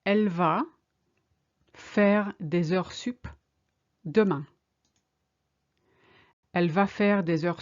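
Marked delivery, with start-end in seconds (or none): 0.0-4.5 slowly, with pauses between chunks; slow, chunked. Shown as a demonstration. Teacher modelling the sentence for learners, slowly chunked. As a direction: break it up for learners. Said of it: "She's going to work overtime tomorrow".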